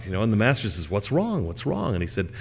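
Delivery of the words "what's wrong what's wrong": higher-pitched, worried "what's wrong"; concerned questioning. Acted. Master to his terrified servant, concerned questioning. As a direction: ask it high, with concern.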